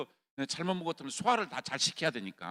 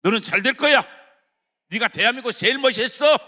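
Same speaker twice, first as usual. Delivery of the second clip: loud, fervent pep talk to oneself; loud self-encouragement; 7 semitones higher, 15 dB louder than usual. Shown as a demonstration. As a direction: shout it into the mirror, much louder. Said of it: "거울에다 대고".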